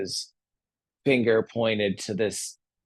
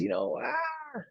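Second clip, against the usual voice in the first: a hint of a scheming genie, pitched higher; higher, lilting pitch for a persuasive genie; lightly voiced genie; 6 semitones higher than usual. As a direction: coax with a lightly acted, higher voice. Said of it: "And the genie says".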